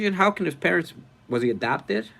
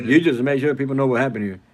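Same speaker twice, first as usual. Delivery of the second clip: louder echo of a movie hero; Gambit; 6 dB louder than usual. Retold or imitated. Streamer copies a film clip just played, loud imitated voice. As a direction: punch out the line in imitation. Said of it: "That is a line from the movie".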